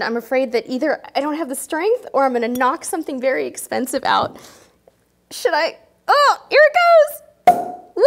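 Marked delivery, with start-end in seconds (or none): 5.3-8.1 high loud whoop as the cork pops; excited squeal. Real, not acted. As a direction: shriek with delight at the pop.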